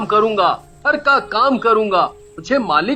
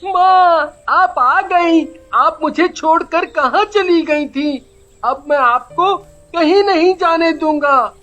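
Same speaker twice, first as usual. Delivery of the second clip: put-on higher, clinging little boy's cry; tearful child; 10 semitones higher, 4 dB louder, slower than usual. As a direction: plead like a child reunited with his mother. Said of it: "लिपट कर रोने लगता है". (माँ से लिपटे बच्चे की रुआँसी पुकार)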